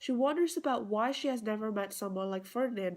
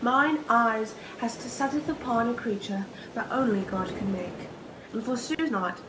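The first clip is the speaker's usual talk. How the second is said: lovestruck maiden, wondering aloud in theatrical verse; earnest stage soliloquy, swelling from musing to ardent love; Miranda's soliloquy; 11 dB louder than usual. Acted. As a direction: declaim it with rising wonder, louder than everyday talk. Performs own Miranda soliloquy, then explains it.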